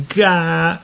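put-on high little girl's repeat; little girl echoing. A narrator's reading. Katie echoing the baby across the room, high voice. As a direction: echo it like a playful child. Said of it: "ripeté Katie dal lato opposto".

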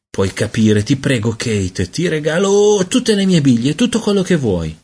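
pleading that climbs high; beg, rising into a high whine; supplichevole. A narrator's reading. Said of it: "supplicò lui". (supplica che sale acuta)

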